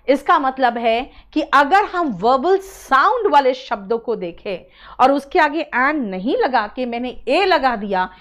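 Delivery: normal talking voice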